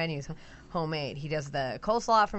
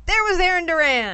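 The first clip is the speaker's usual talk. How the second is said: excited; 10 semitones higher, 9 dB louder than usual. Retold or imitated. Excited reveal of friends at window.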